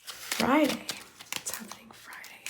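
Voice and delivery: ordinary delivery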